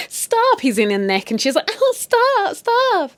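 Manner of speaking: high, giggling mock protest; coy squealy stop, stop; giggly stop. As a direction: play a giggling woman pushing him off. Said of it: "They come down laughing and giggling".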